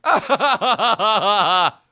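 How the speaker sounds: very high forced "ha ha ha" cackle; mock laughter. Viewer supposedly amused by a Russian accent, mock laughter. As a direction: fake a long hysterical laugh. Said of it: "we're gonna be like".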